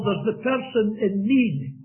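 normal talking voice

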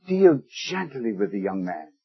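soft, low, tender plea for a son. Retold or imitated. Preacher quoting David's soft plea for his son. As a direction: plead gently as a loving father would. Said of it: "And the last word".

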